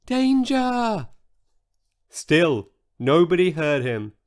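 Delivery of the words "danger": high, thin little shout that barely carries; a tiny high repeated warning; tiny shout again. A narrator's reading. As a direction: call out once more, small and weak. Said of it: "He tried again".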